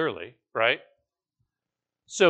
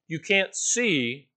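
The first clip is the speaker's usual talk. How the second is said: normal talking voice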